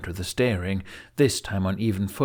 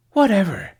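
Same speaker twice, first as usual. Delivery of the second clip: higher, louder, dismissive; dismissive toss-off; 11 semitones higher, 7 dB louder than usual. A narrator's reading. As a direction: throw it away and turn to leave. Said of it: "she threw out".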